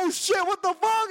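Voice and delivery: high astonished cry; mock amazement. Seeing the hidden spare tire, high amazed outburst. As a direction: gasp it out in disbelief.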